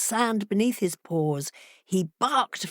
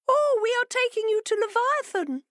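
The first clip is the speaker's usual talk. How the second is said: much higher, smug fish voice; fishes saying. A narrator's reading. Fishes revealing the plan, high and smug. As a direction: announce it smugly. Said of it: "said the fishes".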